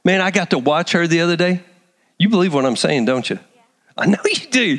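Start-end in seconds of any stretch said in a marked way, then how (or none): none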